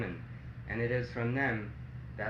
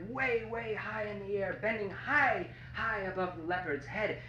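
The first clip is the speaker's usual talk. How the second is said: higher voice climbing with the height; rising high; 7 semitones higher than usual. A narrator's reading. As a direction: lift the pitch way up high. Narrator pitches voice up with height.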